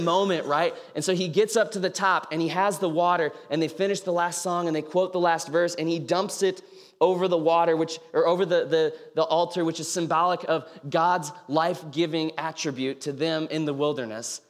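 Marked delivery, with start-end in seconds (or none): none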